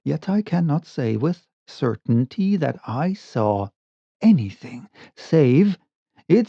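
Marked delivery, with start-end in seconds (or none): none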